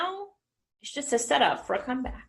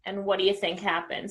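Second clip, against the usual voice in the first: usual speaking voice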